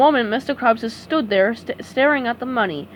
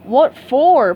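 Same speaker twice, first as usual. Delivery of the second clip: louder baffled question; dopey confusion; puzzled; 3 semitones higher, 7 dB louder than usual. A narrator's reading. Patrick confused by SpongeBob, baffled question. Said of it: "Patrick asked, puzzled".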